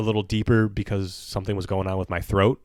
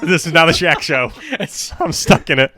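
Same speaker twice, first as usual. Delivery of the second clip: mock trapped; 6 semitones higher, 6 dB louder than usual.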